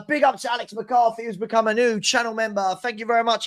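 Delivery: normal talking voice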